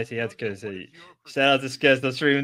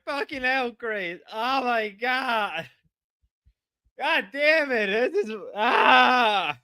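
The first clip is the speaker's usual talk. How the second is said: exasperated groan; 7 semitones higher, slower than usual. Real, not acted. Exasperated at Grace.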